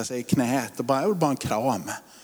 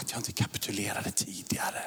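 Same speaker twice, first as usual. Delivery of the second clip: soft unvoiced whisper; hushed prayerful whisper; whispered confession; 7 semitones lower, 10 dB quieter than usual. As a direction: breathe it like a private confession.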